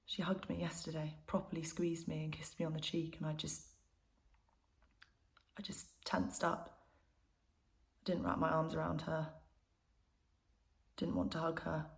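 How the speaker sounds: soft, halting admission; subdued confession. Acted. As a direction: confess it quietly, with pauses between phrases. Confessing she rejected a hug.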